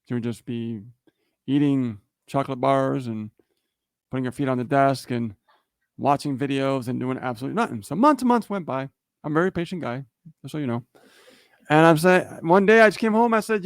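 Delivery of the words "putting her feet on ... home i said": ordinary talk, nothing marked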